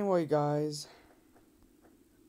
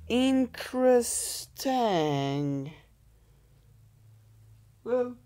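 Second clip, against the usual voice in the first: much higher and louder, a playful theatrical cry; mock dramatic high exclaim; 10 semitones higher, 9 dB louder, slower than usual. Gamer reacting to losing the round, in a high, hammed-up cry. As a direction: ham it up high after the loss.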